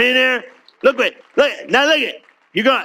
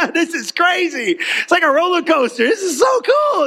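much higher, laughing excited shout; laughing with delight; 7 semitones higher than usual. Retold or imitated. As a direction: whoop it like a roller-coaster ride. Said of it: "I started laughing".

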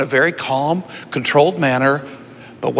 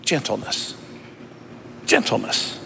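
much softer, lower, almost hushed; soft answer; 9 semitones lower, 10 dB quieter than usual. Real, not acted. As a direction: let the word fall gently and quietly. Answering his own question softly.

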